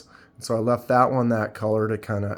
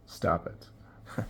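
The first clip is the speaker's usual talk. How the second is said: whispered scolding; 5 semitones lower, 12 dB quieter than usual. Real, not acted. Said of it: "Sorry, I apologize".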